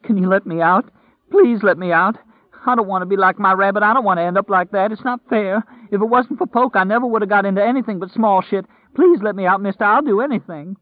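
terrified prisoner pleading through tears; desperate pleading. A narrator's reading. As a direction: beg to be let out, words tumbling. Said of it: "Lloyd sobbed".

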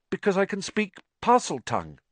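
put-on a boy blurting his secret; earnest boy confessing; anxious young Harry. A narrator's reading.

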